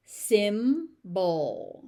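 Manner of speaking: "Symbol" split slowly into sim, bul; stretched teaching model of "Symbol"; syllable by syllable. Shown as a demonstration. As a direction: say "Symbol" in two separate beats. Teacher breaks word into syllables.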